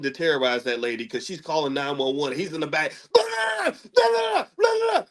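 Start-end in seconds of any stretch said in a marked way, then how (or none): 3.1-5.0 very high garbled mock shouting; hammed-up high-pitched yelling in the background. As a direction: imitate a frantic rant in nonsense bursts. Imitates screaming on a 911 call.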